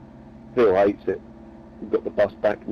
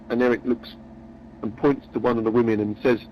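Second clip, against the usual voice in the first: normal talking voice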